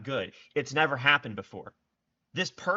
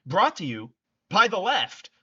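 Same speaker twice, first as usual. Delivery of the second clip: mock sponsor tag; 7 semitones higher, 7 dB louder than usual. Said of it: "mock sponsor tag payoff".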